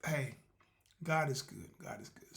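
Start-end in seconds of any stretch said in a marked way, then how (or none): 1.8-2.4 unvoiced, much softer hush; a quiet grateful whisper. Real, not acted. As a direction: breathe it like a private prayer. Second take whispered.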